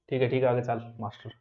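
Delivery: quick, soft, dismissive student; bored student. Pretend. Student impatiently telling the teacher to move on. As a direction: brush the teacher off, fast and low.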